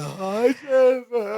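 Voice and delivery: mock-tearful greeting, rising high at the end; through sobs. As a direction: imitate a weepy hello. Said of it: "He greets everyone through his sobs".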